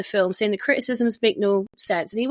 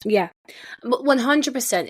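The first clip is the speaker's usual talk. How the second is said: ordinary talk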